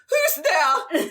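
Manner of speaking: high posh voice, laughing through; calling out as a startled matron; posh lady voice. Acted. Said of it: "and she goes".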